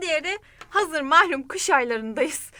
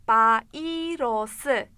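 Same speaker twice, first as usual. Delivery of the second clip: Bayrosu drawn out very slowly, syllable by syllable; 3 semitones lower, much slower than usual. Shown as a demonstration. Teacher slows Bayrosu down for the student. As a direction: stretch out Bayrosu. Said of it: "duyduğunuzdan anlayabiliyorsunuz".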